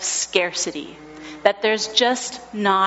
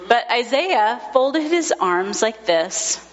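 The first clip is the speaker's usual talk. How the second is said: ordinary talk, nothing marked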